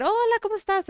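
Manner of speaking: retold cheerful greeting; bright greeting. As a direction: sing out a friendly hello. Greeting customer in retold scene.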